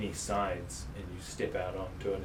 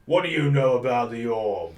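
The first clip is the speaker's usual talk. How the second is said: suspicious guard; 3 semitones higher, 11 dB louder than usual. Acted.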